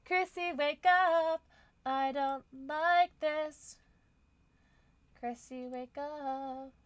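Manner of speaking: slow, high frightened plea; scared pleading. Acted. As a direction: beg her to wake, scared. Scared pleading in horror game.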